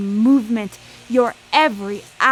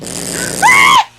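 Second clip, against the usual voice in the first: gasp; 11 semitones higher, 8 dB louder than usual. Acted. Mary attacks Vala, gasp.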